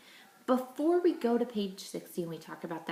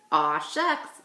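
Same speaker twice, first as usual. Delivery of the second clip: playful aw shucks; 5 semitones lower, 5 dB louder than usual. Shown as a demonstration. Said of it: "I want you to say".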